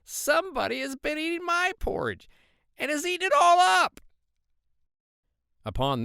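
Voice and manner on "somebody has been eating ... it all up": little shrill bear squealing in protest; very high, loud, tiny-bear voice; shrill cub's cry, building louder to the end; Baby Bear. A narrator's reading. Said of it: "said in his little shrill voice".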